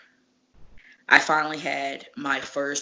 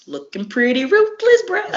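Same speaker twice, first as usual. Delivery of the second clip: teasing compliment; 9 semitones higher, 6 dB louder than usual. Retold or imitated. Teasing Davey about the match.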